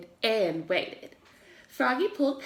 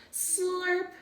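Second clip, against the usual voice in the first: slurp sound effect; 8 semitones higher, 5 dB quieter than usual. A narrator's reading.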